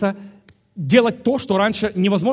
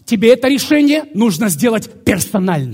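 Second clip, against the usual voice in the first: loud, raised preacher's voice; emphatic preaching; 6 semitones higher, 8 dB louder than usual. Real, not acted. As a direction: drive it home loudly to the congregation. Preacher urges the congregation.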